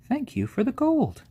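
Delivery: high, loud burst of thanks; sudden excited high thank-you; delighted thanks. Real, not acted. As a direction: thank them with a bright squeal of delight. Viewer gift thanked on stream.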